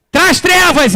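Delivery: a high, lightly hammed-up order; mock command. Preacher mocking the idea of ordering darkness in, mild mock command.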